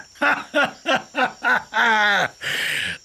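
high, staccato acted belly laugh; mocking lion laughter. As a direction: burst out in scornful ha-ha-ha. (зневажливий левиний регіт)

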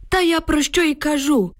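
put-on high, worked-up old man's voice; emotional exclamation. A narrator's reading. Grandfather insists on what he has said, emotional exclamation. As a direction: exclaim it with feeling. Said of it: "емоційно вигукнув дід".

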